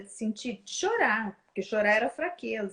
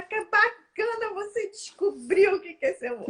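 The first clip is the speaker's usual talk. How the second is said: encouraging parent; 7 semitones higher, 3 dB louder than usual. Acted. Parent praising a child who wants to be a musician, encouraging. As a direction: lift the pitch and stretch the praise.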